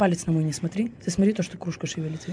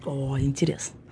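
mock spooky whisper; 5 semitones lower, 5 dB quieter, slower than usual. Mock amazement at mystery.

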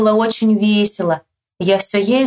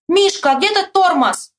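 high urgent call to a friend; high-pitched narrated shout; 9 semitones higher than usual. A narrator's reading. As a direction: holler the question from afar. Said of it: "Я кричу".

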